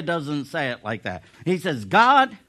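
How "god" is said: crying out. Acted. Jeremiah crying out to God.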